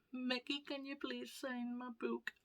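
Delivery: put-on small, high, soft child's voice; little kid. Little kid asking Mickey to sign, small soft voice. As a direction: ask shyly like a little kid. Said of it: "this little kid".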